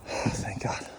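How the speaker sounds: breathless whisper. Real, not acted. Relief after a hard run.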